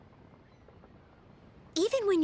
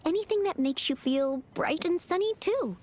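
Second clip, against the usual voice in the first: usual speaking voice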